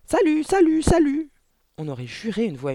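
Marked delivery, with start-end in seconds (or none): 0.1-1.3 very high, loud parrot cry; squawking parrot. A narrator's reading. Parrot suddenly greeting the children, squawking. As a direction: screech it out like a talking parrot. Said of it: "le perroquet s'écria".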